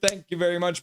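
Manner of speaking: usual speaking voice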